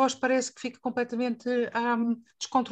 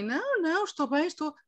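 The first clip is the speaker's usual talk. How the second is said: a hint of insisting you're fine, pitched higher; mock denial; 6 semitones higher than usual. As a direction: brush it off lightly on the phone, voice up. Acted phone call, denying trouble.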